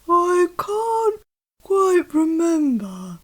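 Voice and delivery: slow, heavy voice of the big Gruffalo father; gruff Gruffalo dad. Acted. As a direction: warn the child like a solemn monster dad. Father hesitates.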